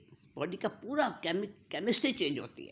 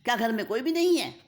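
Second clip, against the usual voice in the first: high, loud calls through the house; calling loudly through an empty house; 8 semitones higher, 5 dB louder than usual. Acted. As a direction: shout for mom and sister. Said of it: "ज़ोर ज़ोर से पुकारती है".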